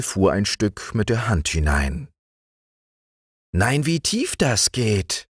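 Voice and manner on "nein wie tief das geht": put-on high, slow, amazed little girl; a child astonished, peering into a hole; wondering. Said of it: "sagte sie verwundert".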